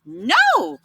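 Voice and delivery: high little pig's cry of refusal. Acted. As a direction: squeal a frightened no. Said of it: "cried the little pig".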